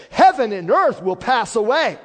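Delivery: very loud, high preacher's declamation; thundering scripture reading. Real, not acted. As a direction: proclaim the prophecy at full force. Declaims scripture at full force.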